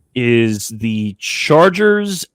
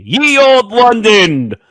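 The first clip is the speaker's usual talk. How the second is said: mock olde English; 9 semitones higher, 4 dB louder, much slower than usual. Mock olde-English flourish on London.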